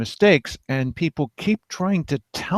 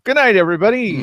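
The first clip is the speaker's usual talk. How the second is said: mock sign-off; 8 semitones higher, 4 dB louder, faster than usual. Real, not acted.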